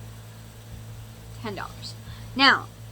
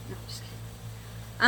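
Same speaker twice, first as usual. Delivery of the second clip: whispered aside; 7 dB quieter, slower than usual. Real, not acted. Joking aside after jab.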